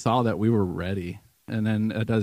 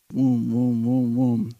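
booming impact sounds; 3 semitones higher, 5 dB louder, much slower than usual. Retold or imitated. Cloud landing on the church.

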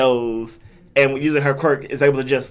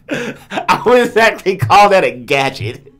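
laughing; 5 semitones higher, 6 dB louder, slower than usual. Real, not acted. Laughing while conceding.

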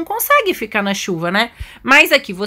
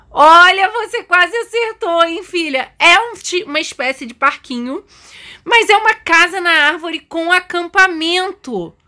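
high, delighted motherly announcement; animated high-pitched praise and reveal; excited reveal; 8 semitones higher, 3 dB louder than usual. Pretend. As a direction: build up the big surprise, pitched high.

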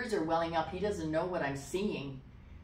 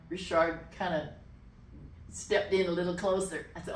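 usual speaking voice

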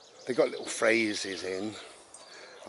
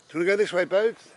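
calling the dog; 10 semitones higher, 8 dB louder, faster than usual. Real, not acted. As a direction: call loudly and high to the dog.

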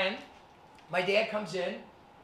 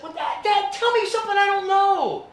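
much higher and louder mock whine at dad; exasperated teen; 12 semitones higher, 9 dB louder than usual. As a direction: retort like a fed-up kid. Son snapping back at dad.

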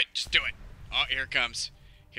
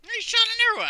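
high squeal; 9 dB louder than usual. Real, not acted. Outraged squeal at arrow.

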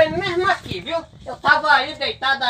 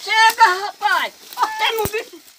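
high, raised scolding voice; reproachful calling; 3 semitones higher than usual. Acted. Digger reproaches his companion for running, calling out high. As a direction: call him back and reproach him.

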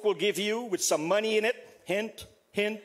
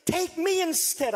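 retold desperate plea, pitched up; pleading; 5 semitones higher than usual. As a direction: plead with God to take you in a child's place. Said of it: "I bargained with God".

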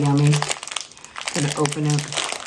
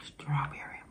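whispering; 16 dB quieter than usual. Real, not acted. Tasting candy.

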